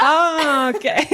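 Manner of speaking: high-pitched, acted-out "it dawns on me" reaction; awkward realisation. Acting her embarrassed realisation at castmates' confession.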